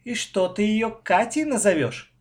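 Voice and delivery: mocking sibling tease; snide. A narrator's reading. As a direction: needle your brother with it. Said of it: "ехидно спросил я брата".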